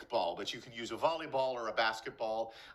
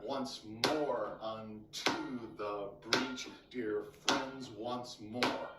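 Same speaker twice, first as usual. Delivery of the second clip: "once MORE unTO the BREACH" slow, pulsed on every stress; measured verse, beating out "once MORE" like a bouncing ball; stressed-syllable beat; much slower than usual. Shown as a demonstration. Teacher showing where the rhythm is, slow and metrical. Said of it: "hear where that rhythm is".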